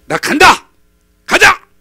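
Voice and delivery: loud, high, furious outburst; angry shout. Retold or imitated. Naaman storming off in anger, shouting. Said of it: "화가 나가지고".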